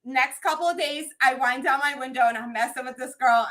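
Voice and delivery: ordinary talk, nothing marked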